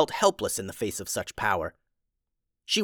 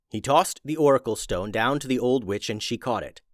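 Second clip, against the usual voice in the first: ordinary delivery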